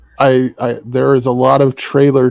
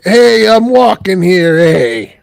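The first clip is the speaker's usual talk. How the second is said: mock New York tough guy; 5 semitones higher, 4 dB louder, slower than usual. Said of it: "Americans would be like".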